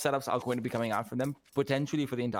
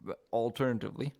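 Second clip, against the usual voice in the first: ordinary delivery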